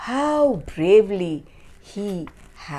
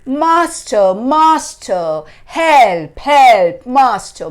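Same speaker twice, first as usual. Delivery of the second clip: loud, high cries for the master; calling for help; 6 semitones higher, 11 dB louder than usual. A narrator's reading. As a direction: call out in alarm, word by word. Stolen harp cries for help.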